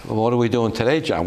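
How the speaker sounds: ordinary delivery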